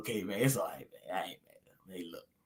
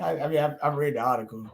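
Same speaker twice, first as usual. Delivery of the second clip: ordinary talk, nothing marked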